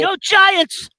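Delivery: high, loud team cheer. Real, not acted. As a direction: shout it like a fan in the stands.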